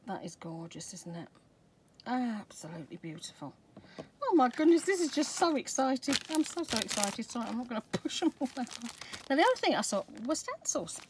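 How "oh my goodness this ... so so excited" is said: higher and louder burst of delight; gushing thrill at the haul; excited. Real, not acted. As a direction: bubble over with excitement. Thrilled unboxing new stamps.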